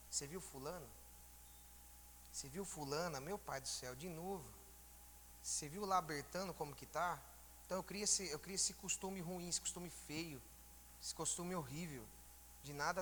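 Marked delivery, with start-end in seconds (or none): 0.1-7.2 acted hushed gossip, softer than usual; critical gossip. Churchgoer criticizing someone to another at home, hushed critical gossip. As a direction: quietly mimic someone picking others apart. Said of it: "Toda vez eu tô criticando".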